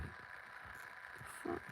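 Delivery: breathless whisper of disbelief; whispered the fuck. Retold or imitated. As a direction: mouth it in stunned shock. Stunned whisper at payment.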